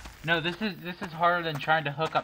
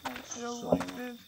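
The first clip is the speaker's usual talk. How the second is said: higher, soft, frightened panting; scared and breathless; 7 semitones higher, 10 dB quieter than usual. Real, not acted. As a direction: whimper it, out of breath. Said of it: "I'm breathing really hard".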